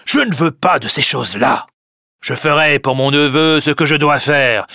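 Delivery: loud, high-pitched irritated outburst; angry scolding. A narrator's reading. Grandet complains to his family about the nephew, loud and angry. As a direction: complain loudly and crossly.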